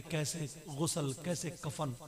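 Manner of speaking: normal talking voice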